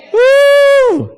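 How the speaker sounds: loud, very high whoop; joyful high whoop. Real, not acted. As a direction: whoop with excitement to the crowd. Preacher's exclamation of joy.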